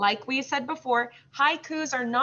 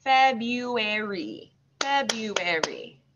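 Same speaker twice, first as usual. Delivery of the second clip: February in long, joined syllables; slow level teaching "February", easing off; much slower than usual. Shown as a demonstration. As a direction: draw out every beat of "February". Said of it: "then we'll say it slow".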